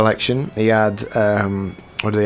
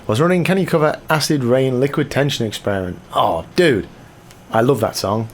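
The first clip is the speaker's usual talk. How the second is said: usual speaking voice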